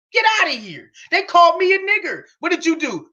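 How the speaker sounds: usual speaking voice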